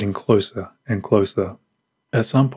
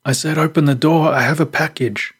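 higher-pitched, irritated demand; angry call; 7 semitones higher than usual. A narrator's reading. Stranger ignored at the locked door, calling out angrily. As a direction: call out angrily, insisting on being obeyed. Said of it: "The man called out angrily".

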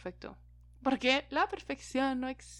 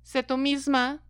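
normal talking voice

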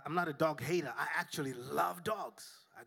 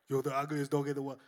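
mock insult; 5 dB louder, faster than usual. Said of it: "look at that dog and say".

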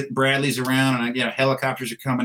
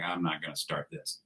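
muttered to himself; 6 semitones lower, 13 dB quieter than usual. Retold or imitated. Holding back before a colonel.

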